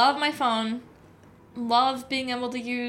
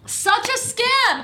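mock outrage; 11 semitones higher, 4 dB louder, much slower than usual.

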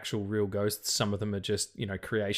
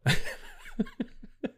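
high burst of laughter; real laugh. Real, not acted. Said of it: "laugh after joke".

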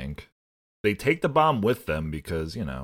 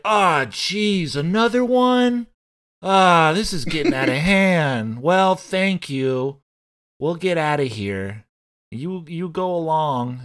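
high, loud, hammed-up dismay; mock-fluster it, pitched way up; exasperated cop; 8 semitones higher, 6 dB louder than usual. Host playing a cop handed yet another bomb, hammed-up exasperation.

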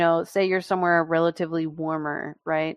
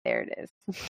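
deadpan retort; 12 dB quieter than usual. Retold or imitated. Pushing them down, retort.

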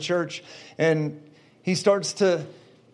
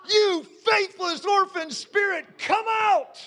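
high, slow, shouted command; preacher yelling come out; shouted rebuke; 11 semitones higher, slower than usual. Retold or imitated.

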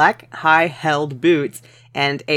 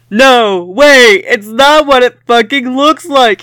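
high, loud hammed-up protest; high loud melodramatic denial; mock shout; 9 semitones higher, 7 dB louder than usual. A narrator's reading. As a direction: plead loudly, over the top. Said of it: "he shouted".

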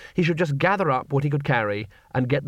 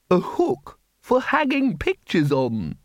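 higher, slow chirpy bursar; bright; 6 semitones higher, slower than usual. A narrator's reading. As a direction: chirp it innocently. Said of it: "said the bursar brightly".

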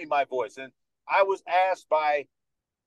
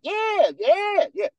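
much higher, eager yeah yeah; excited shout; much slower than usual. Retold or imitated. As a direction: yell it in eager agreement.

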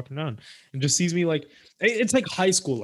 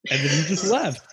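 ordinary talk, nothing marked

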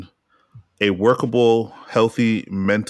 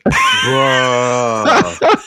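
groaning laugh; 5 dB louder than usual. Real, not acted. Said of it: "Come on, man".

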